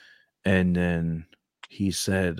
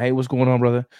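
higher, louder friendly hello; upbeat greeting; 7 semitones higher, 9 dB louder than usual. Retold or imitated. Recruiter greeting a student on campus, bright and loud. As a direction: call out cheerfully to a stranger.

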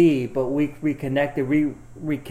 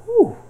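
relieved whew; 10 dB louder than usual. Retold or imitated. Reaction to pregnancy news.

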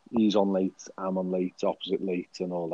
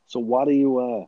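hammed-up American tough talk, pitched up; American accent; 5 semitones higher than usual. Podcaster asking co-host what he's packing, mock American accent. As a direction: play at being American, badly. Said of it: "let's try and talk American".

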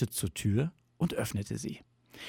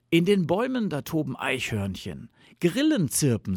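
lightly excited, pitched-up fox voice; enthusing fox; 6 semitones higher, 3 dB louder than usual. A narrator's reading. Fox tempts Bear with summer, enthusing. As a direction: list the delights with a lift.

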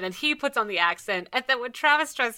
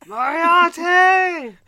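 long, high, full-volume shout; drawn-out theatrical holler; screaming Moriarty; 10 semitones higher, 9 dB louder, much slower than usual. Acted. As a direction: scream the name out at the top of your lungs, stretching it. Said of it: "just start screaming Moriarty".

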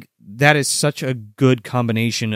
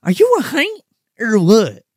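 character voice; 6 semitones higher, 4 dB louder than usual. Acted. Quoting movie line in character.

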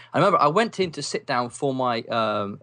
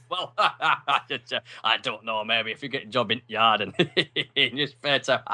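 laughing through dismissive words; mocking laughter; slower than usual. Careers advisor laughing off the boy's dream, chuckling. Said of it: "pissed himself laughing at this".

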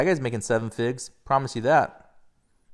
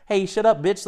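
high, louder mock put-down; imagined comeback; 10 semitones higher, 6 dB louder than usual. Bellinger answering the trash talk, a mocking comeback. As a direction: snap back with a taunting comeback. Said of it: "Cody Bellinger coulda, shoulda said".

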